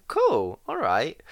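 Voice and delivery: breezy brush-off. Retold or imitated. Imagined casual reply to coming out.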